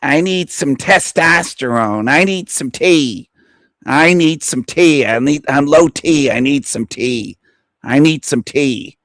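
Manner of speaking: mock a guy begging for testosterone; hammed-up needy impression; EBT voice impression. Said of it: "I'll do my E B T voice impression".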